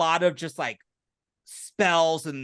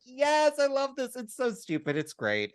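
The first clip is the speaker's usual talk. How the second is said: excited; 9 semitones higher than usual. Retold or imitated. Gleeful reaction quoted while watching.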